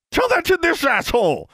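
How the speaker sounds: high loud furious shout. A narrator's reading. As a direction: scream it at the dwarf.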